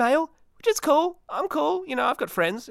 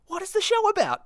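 squeaky topic question. Acted. Announcing the day's topic.

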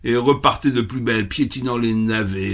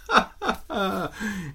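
rabbit's scornful little laugh; mocking laugh; 7 semitones higher, 6 dB quieter than usual. Rabbit laughing at the bee, mocking. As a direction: laugh at the bee's idea. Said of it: "Et il se mit à rire".

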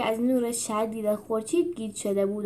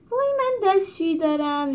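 squeezed animal voice; 10 semitones higher than usual. A narrator's reading. Animals crammed in cage.

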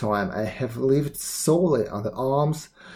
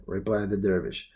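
ordinary delivery, nothing marked

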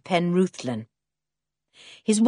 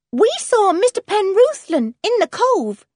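put-on high, loud child's voice blurting out the news; high-pitched blurt; young Jan; 6 dB louder than usual. A narrator's reading. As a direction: pipe up high and eager, breaking the news.

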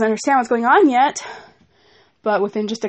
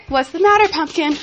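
tender mom voice; 5 semitones higher than usual. Real, not acted. Mother soothing her toddler.

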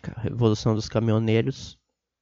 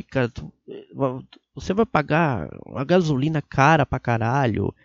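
usual speaking voice

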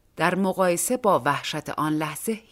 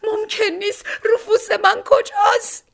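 much higher, shrill shrieking woman's voice, louder at the end; 5 dB louder than usual. A narrator's reading. Distraught woman at the airport, high shriek. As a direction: shriek it in panic, voice pitched way up. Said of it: "با صدای جیغواری فریاد زد".

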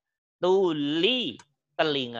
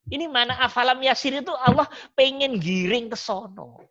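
high-pitched, driving sermon voice; fervent preaching; 9 semitones higher than usual. Acted. Ustaz preaching to students about God's signs on earth, fervent and high-pitched. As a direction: press the point hard, rising and insistent.

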